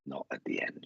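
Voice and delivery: low, soft, almost whispered; hushed. Retold or imitated. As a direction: relay it as a quiet admission. Relaying her sad admission.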